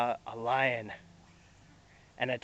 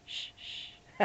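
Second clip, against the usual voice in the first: soft whispered hushing; 13 dB quieter than usual. Real, not acted. Hushing the baby.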